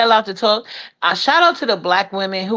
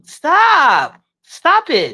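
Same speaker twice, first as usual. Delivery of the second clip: exasperated; 5 semitones higher, much slower than usual. Real, not acted. Telling off the other woman.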